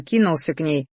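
plain reading voice

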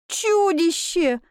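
high, drawn-out mock scream; wailed. A narrator's reading. Maid screaming at the heroine's back, high wail. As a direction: wail it high without real volume. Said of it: "завопила она мне в спину".

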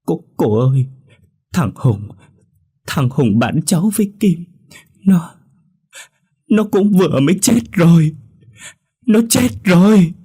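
put-on girl sobbing to the woman that her friend died; sobbing wail. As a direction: let the grief break through the words. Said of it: "khóc mếu máo".

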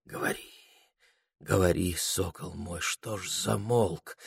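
very soft, hushed voice; soft babbling coax. A narrator's reading. The flame coaxing its falcon to speak, soft babble. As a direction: coax gently under your breath. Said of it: "пролепетало пламя".

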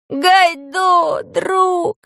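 put-on very high, loud boyish cry; high, stretched-out hail; joyful shout. A narrator's reading. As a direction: call out to a long-lost friend. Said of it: "закричал Пашка".

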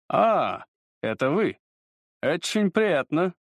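slow, mannered society-fop tone; affected drawl. A narrator's reading. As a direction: play a bored dandy, drawn out. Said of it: "ломаным, вычурным тоном".

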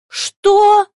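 very high, loud squeal of shock; almost squeal the question out; near shriek. A narrator's reading. Woman learns her partner has departed, near shriek. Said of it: "практически взвизгнула Екатерина Алексеевна".

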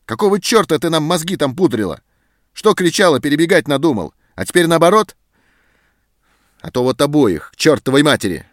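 loud, high, raging tirade; furious shouting. A narrator's reading. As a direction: rage at them both. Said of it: "взъярился сержант".

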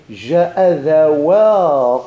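loud, very slow, long-held final vowel; drawn-out model form. Shown as a demonstration. Teacher showing students how to form the dual, drawn out. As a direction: stretch it out loudly for the class.

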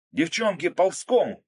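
high, loud urgent call; shouted command. A narrator's reading. Narrator to the women under fire, urgent shout. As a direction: yell the warning to them. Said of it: "крикнул я".

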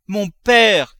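very loud, high, piercing call; ringing cry. A narrator's reading. Daughter crying out so the servant runs up, ringing cry. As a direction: let the cry ring through the house. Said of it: "cria Eugénie d'une voix si éclatante".

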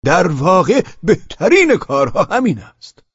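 high, booming, drawn-out approval; loud voice. A narrator's reading. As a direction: proclaim it loudly and slowly. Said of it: "با صدای بلند". (با صدای بلند و کشیده)

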